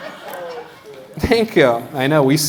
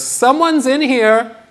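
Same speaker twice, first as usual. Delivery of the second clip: person in the bathroom; 10 semitones higher, 3 dB louder, slower than usual. Retold or imitated. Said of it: "the person inside goes".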